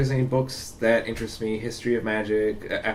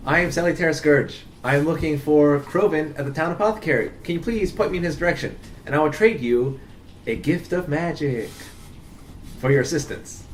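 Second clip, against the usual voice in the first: higher-pitched, formal hail to a stranger; grandly courteous adventurer; 3 semitones higher than usual. Acted. Mage asking a villager for directions, grandly courteous. Player speaks as Salutaire Scourge.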